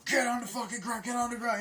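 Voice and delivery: high barked yell of police; screaming get on the ground; shouting cops. Retold or imitated. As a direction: yell it like a cop making an arrest. Cops yelling at Sailor.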